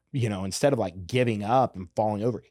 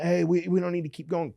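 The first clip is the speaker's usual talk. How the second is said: lightly imitated onlooker, pitched up; typical bystander; 9 semitones higher than usual. Fighter quotes the typical peacemaker in the crowd, light imitation. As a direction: mimic someone talking the fighters down. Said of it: "Doing the, the typical".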